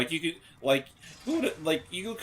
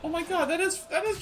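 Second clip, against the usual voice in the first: high-pitched surprised gasp; 11 semitones higher than usual. Real, not acted. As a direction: squeal in disbelief. Surprised recognition of boss.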